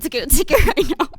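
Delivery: laughing through the words. Real, not acted. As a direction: giggle it out, amused. Laughing while retelling.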